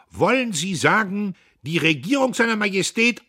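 loud, high-pitched accusing question; outraged challenge. A narrator's reading. Dietrich confronts the heckler, outraged shout. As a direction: round on the heckler at full voice.

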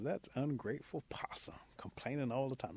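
whispered private griping; muttered thought. Acted. Rabbit thinking about ungrateful Possum, muttering in a whisper. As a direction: whisper it under your breath. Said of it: "Rabbit's been thinking".

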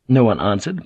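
usual speaking voice

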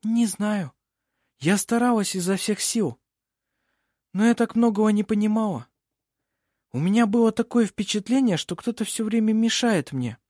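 put-on high-pitched, helpless young woman; miserable, dejected. A narrator's reading. Dejected girl answers the lawyer in court, miserable. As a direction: plead that you tried your best. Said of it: "совсем несчастной и крайне удручённой".

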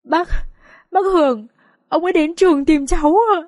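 high, halting tearful voice; crying. Acted. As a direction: stammer the news through tears. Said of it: "sao lại khóc".

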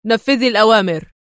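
loud stern order; stern shout. A narrator's reading. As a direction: shout the order to end the argument. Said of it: "صاح بها في صرامة".

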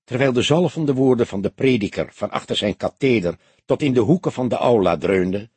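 plain reading voice

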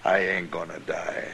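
breathed low under the breath; whispered. Acted. Cornered outlaw to the marshal, a low whisper.

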